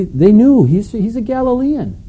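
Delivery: high-pitched, insistent accusing voice; excited accusation. Retold or imitated. Bystanders exposing Peter as a Galilean, high excited accusation. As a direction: lift the voice high as if exposing him.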